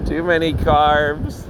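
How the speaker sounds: ordinary talk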